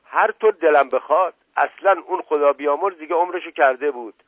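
high, loud, defiant scolding; indignant retort. A narrator's reading. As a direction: snap back at the officer loudly. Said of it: "عزیز السلطنه ناگهان برآشفت". (حاضرجوابی خشمگین و بلند)

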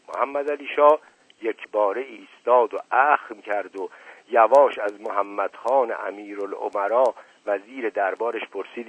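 plain reading voice

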